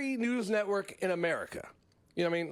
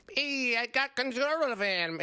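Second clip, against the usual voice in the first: higher, slower tiny-creature voice with a hint of Russian; little Martian pilot; 5 semitones higher, slower than usual. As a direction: play the little guy hiding under the hat. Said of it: "slight Russian accent".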